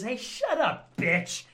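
high, loud hammed-up command; mock-aggressive telling-off; mock bullying. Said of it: "you grab her by the face".

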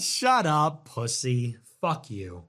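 ordinary delivery

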